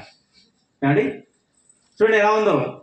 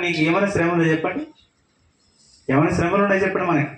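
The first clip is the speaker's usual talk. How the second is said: normal talking voice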